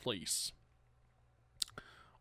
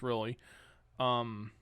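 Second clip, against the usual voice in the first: ordinary talk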